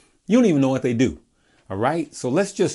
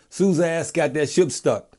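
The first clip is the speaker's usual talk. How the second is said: lightly mimicked friend, higher, "Sue's ass" for Suez; repeating the buddy's words to viewers, light mimicry; quoting him exactly; 6 semitones higher, 3 dB louder than usual. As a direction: repeat the buddy's mangled line, a bit higher. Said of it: "This is the way he said it".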